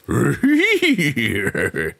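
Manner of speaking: high loud burst of laughter. Shown as a demonstration. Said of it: "One more laugh".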